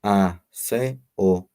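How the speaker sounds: slow, separated syllables with pauses. Shown as a demonstration. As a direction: break the word into its separate sounds. Teacher splits word into syllables.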